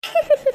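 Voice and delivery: short bashful giggle after tears. Acted. Teased about a dream Romeo, a shy giggle. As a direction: let a little laugh escape. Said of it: "மூஞ்சி அப்படியே ... பிரகாசமா".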